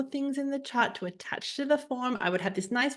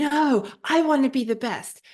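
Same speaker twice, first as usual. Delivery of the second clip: higher, louder stubborn objection; mock protest; 5 semitones higher, 5 dB louder than usual. Acting out her objection to being outdone, mock protest. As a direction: protest it like a sore competitor.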